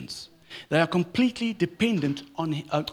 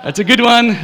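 much higher and louder, sarcastic cheer; mock fake amusement; 8 semitones higher, 12 dB louder than usual. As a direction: play the forced polite laugh line, big and bright. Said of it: "It's like".

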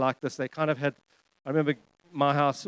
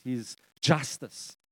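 usual speaking voice

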